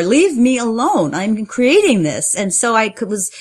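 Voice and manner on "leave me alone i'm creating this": acted protest, higher and louder; slow, loud retort to an inner critic; fighting back. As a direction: push the inner critic away, slowly and firmly. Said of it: "fighting with my internal critic".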